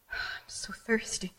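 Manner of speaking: slow, spent whisper after a sigh; weak whisper. Acted. Performer in breakdown craves a drink, weak sighing whisper. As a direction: whisper it, drained and craving.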